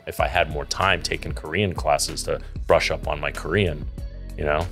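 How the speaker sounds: ordinary talk, nothing marked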